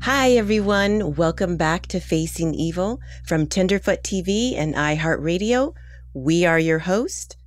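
ordinary delivery

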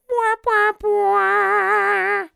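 very high honking horn blasts. Retold or imitated. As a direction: blare like a comic horn. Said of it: "Rootin' Tooty to be like".

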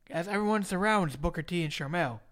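ordinary talk, nothing marked

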